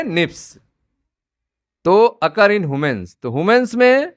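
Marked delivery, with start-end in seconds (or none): 0.0-0.6 one falling syllable, "nips", for SNPs; SNPs pronounced "nips". Shown as a demonstration. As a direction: softly show SNPs said as "nips". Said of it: "इसको pronounce कैसे करेंगे".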